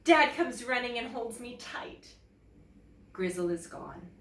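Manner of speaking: usual speaking voice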